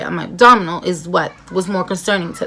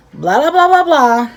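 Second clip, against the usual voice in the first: higher, bored blah-blah filler; dismissive blah blah; 6 semitones higher, 4 dB louder than usual. Retold or imitated. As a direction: wave it off as boring chatter. Dismissive filler after quote.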